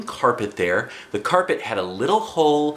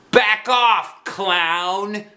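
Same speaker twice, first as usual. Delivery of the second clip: shouted; 10 semitones higher, much slower than usual. Acted. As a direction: yell it to scare him off. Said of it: "I shouted".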